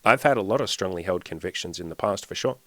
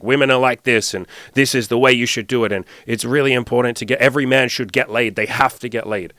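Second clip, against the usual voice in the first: loud lecturing; 4 semitones higher, 7 dB louder than usual. Retold or imitated. Mocking his former lecturing self.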